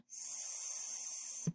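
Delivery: s as a bare hiss, no voice; just the sound s for spelling; letter sound s. Shown as a demonstration. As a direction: whisper the sound s. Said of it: "So I hear s".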